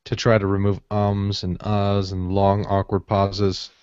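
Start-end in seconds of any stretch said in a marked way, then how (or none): none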